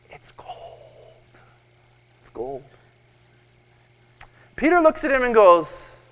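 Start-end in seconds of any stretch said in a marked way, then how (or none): 0.1-2.6 hushed, secretive mock whisper. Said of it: "the man whispers to Peter".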